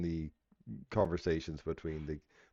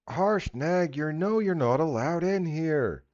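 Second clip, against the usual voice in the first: high, loud guard calling out; 10 semitones higher, 5 dB louder, slower than usual. Acted. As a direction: call out sharply from the gate. DM voices gate guard.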